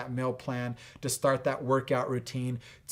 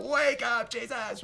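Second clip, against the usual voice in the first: mock urgent call; 9 semitones higher than usual. Disciples wake sleeping Jesus.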